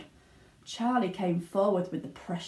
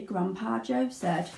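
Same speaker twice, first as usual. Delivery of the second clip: plain reading voice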